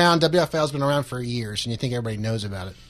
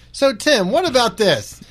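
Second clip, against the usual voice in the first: higher, louder challenge; imitated needling roommate; 9 semitones higher, 5 dB louder, slower than usual. Roommate lifting one headphone to poke at Tim, needling. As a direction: poke at someone with a loud, high-pitched question.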